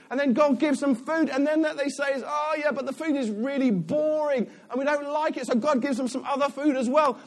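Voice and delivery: high, loud whingeing tirade; mock moaning. Preacher mimicking Israelites moaning at Moses, hammed-up whingeing. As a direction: pile up the complaints in a high, relentless moan. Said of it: "They just moaned".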